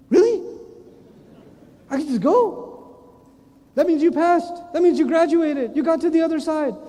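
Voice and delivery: high, loud, overjoyed "really?"; high, loud and overjoyed at passing through; thrilled disbelief. Acted. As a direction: burst out like you can't believe your luck.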